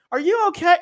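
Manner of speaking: high, loud worried ask; hammed-up sweet concern; concerned fan. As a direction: fan checks on the huddled star in a high voice. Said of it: "she's like".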